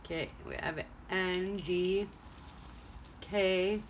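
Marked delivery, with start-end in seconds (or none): none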